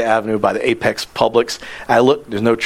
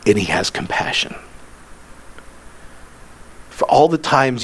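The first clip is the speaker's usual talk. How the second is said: intimate sermon close; 4 semitones lower, 4 dB quieter than usual. Acted. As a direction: draw them in gently.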